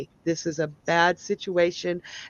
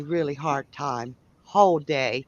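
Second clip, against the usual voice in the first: usual speaking voice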